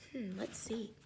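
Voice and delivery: plain reading voice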